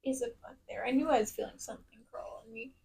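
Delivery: unvoiced whisper. Real, not acted. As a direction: whisper it nervously. Bug crawling on her.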